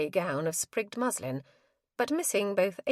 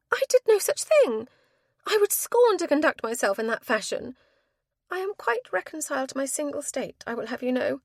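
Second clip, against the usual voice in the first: indignant denial; 8 semitones higher, 5 dB louder than usual. A narrator's reading.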